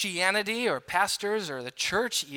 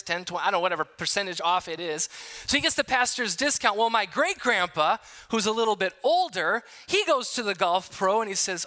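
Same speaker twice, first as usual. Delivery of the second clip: usual speaking voice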